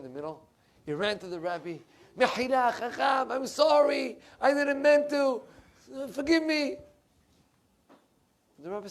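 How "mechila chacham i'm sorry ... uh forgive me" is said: high, loud frantic begging; pleading apology. Retold or imitated. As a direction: cry out for forgiveness, acting the panicked showman. Showman runs to Rebbe begging forgiveness.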